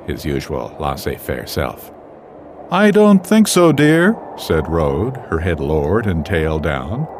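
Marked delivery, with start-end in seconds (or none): 2.7-4.2 higher, louder she-wolf voice; female character voice. Acted. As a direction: play the worried mother wolf.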